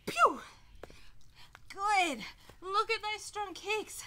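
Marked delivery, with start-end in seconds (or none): 0.1-0.4 high loud pew on the kick; kick sound effect. Shown as a demonstration. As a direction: fire it out like a punch. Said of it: "So we wanna go".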